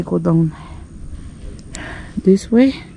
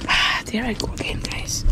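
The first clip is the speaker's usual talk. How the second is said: 1 breathy whisper; 4 semitones higher, 8 dB quieter than usual. Real, not acted. Climbing a scary edge, whispering.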